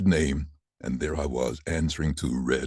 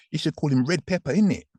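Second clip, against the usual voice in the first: high put-on London voice ending on isn't it; local London accent; 13 semitones higher, 3 dB louder than usual. Locals over here naming him, in a high London accent. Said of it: "as they say over here".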